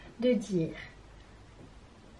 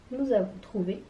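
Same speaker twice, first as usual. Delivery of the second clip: ordinary talk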